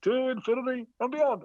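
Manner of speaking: a wife's playful hint of the toy hero; Buzz Lightyear catchphrase. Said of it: "all I heard was".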